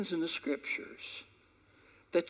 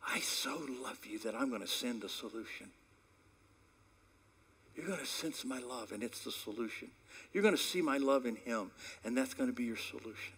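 tender voice of God; 5 semitones lower, 6 dB quieter than usual. Acted. God reassuring his children, whispered tenderness. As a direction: whisper it, soft and loving. God's voice rendered softly.

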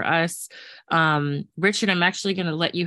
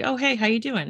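light, higher-pitched breezy greeting; casual politeness; 7 semitones higher than usual. Retold or imitated. Host imitates an everyday polite check-in, casual and breezy. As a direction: toss off a polite, automatic hello. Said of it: "just, like, being polite thing".

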